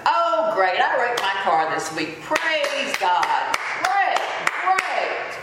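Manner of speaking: sarcastic cheer, hammed up; mock praise. Mocking a believer who thanks God for a wreck, fake cheer. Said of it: "God knows that we're not gonna".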